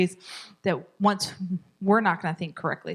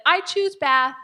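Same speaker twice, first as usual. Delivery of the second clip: higher, gentle but firm choice; kindly decide for the child, lightly higher; calm loving parent; 6 semitones higher, 4 dB louder, slower than usual. Shown as a demonstration. Parent calmly choosing bath for resisting child. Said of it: "Remember, very loving, very calm".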